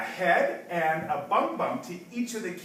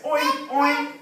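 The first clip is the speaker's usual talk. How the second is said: higher-pitched oinks; pig oink; 6 semitones higher, 4 dB louder than usual. Acted. Teacher showing English pig sound, high oinking. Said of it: "A pig says".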